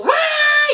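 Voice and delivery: high, loud mock baby cry; imitated newborn wail. Mother imitating her newborn screaming at birth, high mock wail.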